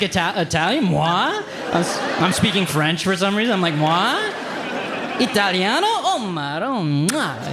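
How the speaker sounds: flattered fake Italian, high and coy; a hint of hammed-up Italian charm; fake Italian accent. Comic flattered at being called Italian, fake Italian accent. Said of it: "It's fake Italian".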